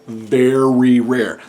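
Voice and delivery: slow stretched very rare; drawn-out emphasis. Shown as a demonstration. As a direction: lean long on very rare.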